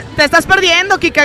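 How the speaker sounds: ordinary talk, nothing marked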